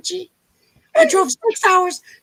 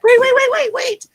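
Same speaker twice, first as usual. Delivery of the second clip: higher, louder calls to stop; loud urgent wait; 7 semitones higher, 3 dB louder than usual. Real, not acted. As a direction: urgently halt everyone. Stops everyone for moment.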